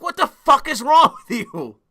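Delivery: normal talking voice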